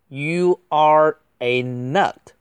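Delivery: emphatic, drawn-out delivery; slow model reading. Shown as a demonstration. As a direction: stretch out each word for learners. Slow model reading, then faster repeat.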